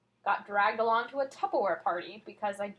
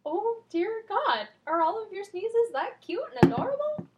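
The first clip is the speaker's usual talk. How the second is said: teasing high voice; 9 semitones higher, 4 dB louder than usual. Real, not acted. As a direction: coo over it mockingly. Teasing friend's cute sneeze.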